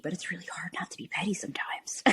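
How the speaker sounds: hushed, breathy aside, almost unvoiced; whispered confession. Real, not acted. To the host, owning up to pettiness in a whisper. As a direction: let the guilty admission slip out as a whisper. Confessing she gets petty online.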